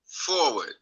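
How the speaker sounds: normal talking voice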